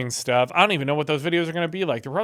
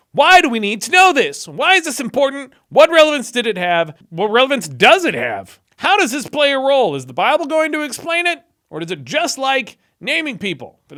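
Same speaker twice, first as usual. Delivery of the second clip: higher, louder, fed-up rhetorical questions; exasperated questioning; 8 semitones higher, 6 dB louder than usual. Real, not acted. Vlogger baffled by the name list, exasperated and loud. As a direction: throw the questions up in disbelief.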